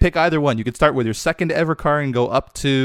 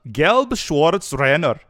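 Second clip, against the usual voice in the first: slow, careful German that sounds Scottish; botched German accent; 3 semitones higher, 3 dB louder, much slower than usual. Acted. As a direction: attempt German and come out Scottish. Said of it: "It was still Scottish".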